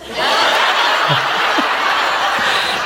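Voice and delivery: a short, breathy laugh. Real, not acted. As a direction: laugh off the punchline. Laughs at punchline.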